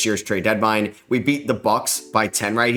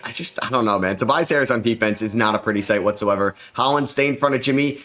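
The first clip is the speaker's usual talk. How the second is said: normal talking voice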